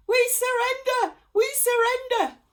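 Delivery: very high, very loud surrender scream. A narrator's reading. Bandits screaming surrender at the cats, very loud. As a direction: scream it out in terror. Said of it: "screamed the bandits".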